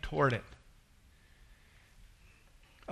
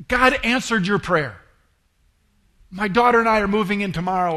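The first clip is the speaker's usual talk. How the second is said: higher and much louder, fast with joy; excited news; 5 semitones higher, 9 dB louder than usual. Retold or imitated. Woman telling her pastor about the new home, excited. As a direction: blurt out the good news. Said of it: "She was so bright and excited".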